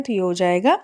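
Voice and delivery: ordinary talk, nothing marked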